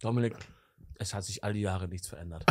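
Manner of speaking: leise, tief, fast zu sich selbst; nachdenklich leise. Retold or imitated. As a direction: sentimental im Kinderzimmer murmeln. (leises nostalgisches Sinnieren)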